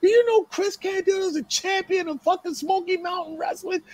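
outraged, very high-pitched yelling; retold screaming objection, voice way up; going nuts. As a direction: imitate a promoter flatly refusing in disbelief. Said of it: "He's going nuts".

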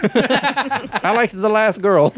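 high, slow, loud mom wail; drawn-out high mother's complaint; mock mom voice. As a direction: lament like a disappointed mother. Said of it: "His mom".

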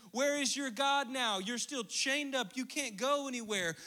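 jeering; mocking taunt. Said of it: "they're probably ridiculing him, saying".

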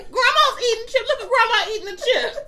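put-on grandma baby-talking her grandchild; mock grandma. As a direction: coax like talking to a kid. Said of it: "Like I'm a kid".

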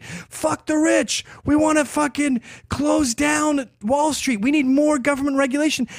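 mock protester shouting. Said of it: "They're screaming anti-cop stuff".

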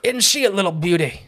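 cheerful Australian wildlife-host accent, lightly hammed; Steve Irwin impression. Doing Steve Irwin for his co-host, hammed-up Australian accent. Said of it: "if you go full Steve Irwin".

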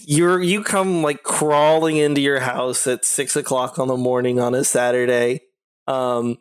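ordinary talk, nothing marked

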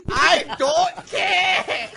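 slow, drawn-out high yell of I don't care; mock-exasperated bartender yell; exasperated shout. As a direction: shout it out, fed up with them. Bartender snapping at mock customer.

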